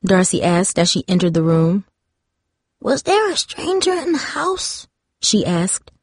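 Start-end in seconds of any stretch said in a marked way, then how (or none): 2.8-4.9 put-on high, shaky old woman; frail wavering voice. A narrator's reading. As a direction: ask like a frightened frail grandmother. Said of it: "her frail voice wavering".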